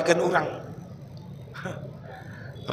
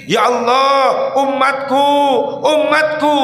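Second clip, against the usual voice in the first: rising plea; 8 semitones higher, 3 dB louder, slower than usual. Acted. Preacher pleading for the people.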